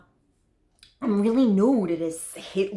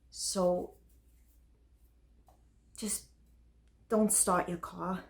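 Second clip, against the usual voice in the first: soft, resigned plea; subdued warning; 7 dB quieter than usual. Acted. Date parting after the bomb confession, soft subdued warning. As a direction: let the warning drop away quietly.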